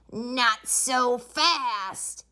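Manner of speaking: slow, menacing and unpleasant; unpleasant hiss. A narrator's reading. Strict new teacher stopping the class, menacing. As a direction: draw it out slowly like a mean witch. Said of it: "hissed an unpleasant voice".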